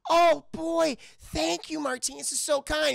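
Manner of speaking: high, gushing mock sweetness; delighted thanks. Olive receives a Christmas present, delighted high-pitched thanks.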